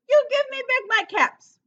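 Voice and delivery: loud, high scolding peddler; angry scolding. A narrator's reading. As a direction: shake a finger and scold the monkeys. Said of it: "he said, shaking his finger at them".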